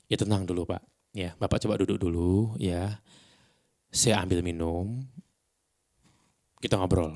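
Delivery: calm, unhurried midnight DJ voice; even, soothing delivery with long gaps; late-night radio host. Shown as a demonstration. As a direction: talk them down gently, leaving pauses. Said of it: "bawa acara radio tengah malam ya".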